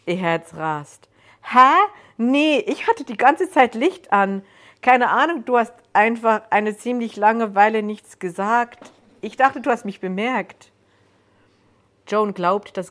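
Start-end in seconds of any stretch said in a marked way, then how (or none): 1.4-10.7 a casual stranger, higher and louder, a bit taken aback; lightly voiced stranger. Stranger in the woods explains himself to startled Joan, higher and louder. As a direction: play the cheerful intruder, pitched up and loud.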